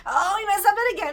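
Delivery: high, loud, fast anguished cry; Hashem crying. Retold or imitated. Hashem lamenting over his people, high anguished cry. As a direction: cry it out as a grieving God.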